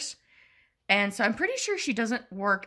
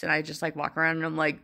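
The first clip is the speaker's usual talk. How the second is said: normal talking voice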